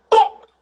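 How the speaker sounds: a loud, high bop; punch sound effect. Retold or imitated. Nan hitting the woman, punchy sound effect.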